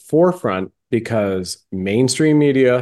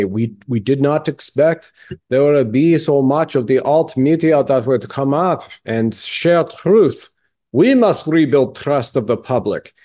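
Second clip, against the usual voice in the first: higher, hammed-up German-accented speech; mock German accent; 4 semitones higher than usual. Podcast guest paraphrasing a Davos speech, mock German accent. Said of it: "you know whose accent I'm doing right there".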